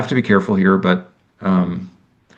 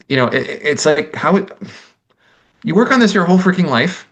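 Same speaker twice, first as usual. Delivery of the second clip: getting emotional; 9 semitones higher than usual. Real, not acted. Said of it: "I'm getting emotional again".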